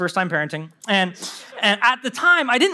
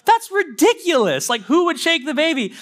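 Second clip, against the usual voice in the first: higher, slow, incredulous scoff; scoffing disbelief; 7 semitones higher than usual. Retold or imitated. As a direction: laugh off the idea as absurd. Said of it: "I'm like".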